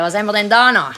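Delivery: ordinary talk, nothing marked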